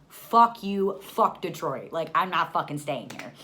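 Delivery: loud, punchy retold tirade; angry outburst. Stranded mother cursing the airline guy, angry outburst. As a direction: blow up at them, opening loudest.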